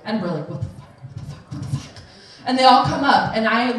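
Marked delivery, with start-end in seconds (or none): 0.5-1.9 hushed, voiceless repeated disbelief; stunned whisper. Retold or imitated. Stunned friends on the porch.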